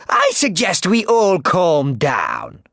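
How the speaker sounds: Cruella. Acted. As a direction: purr it slowly, in control. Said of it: "said Cruella".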